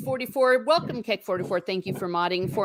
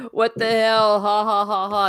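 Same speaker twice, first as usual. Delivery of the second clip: mocking vampire laugh; 4 dB louder than usual. Retelling the vampire facing the cross, mock laughing. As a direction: imitate a vampire scoffing through laughter. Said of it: "vampire is all laughing, saying".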